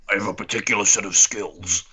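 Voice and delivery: a hint of Liam Neeson, low and steady; lightly voiced menacing Liam Neeson line; understated Neeson threat, low and calm; Liam Neeson impression. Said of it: "the perfect Liam Neeson".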